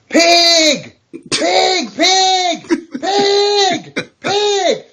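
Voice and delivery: high-pitched drawn-out yell; yelling lady. Acted. Lady yelling at a driver from her car window, high drawn-out yell. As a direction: holler it high, one long word at a time. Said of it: "she yells out".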